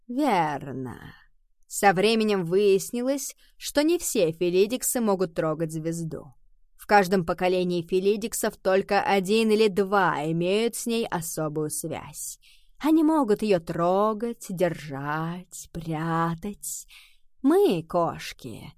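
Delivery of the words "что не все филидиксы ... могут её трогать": plain reading voice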